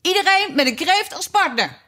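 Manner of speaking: high-pitched excited cry; Gryphon's high, acted shout. A narrator's reading. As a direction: call it out high to all the dancers. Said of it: "schreeuwde de Griffioen".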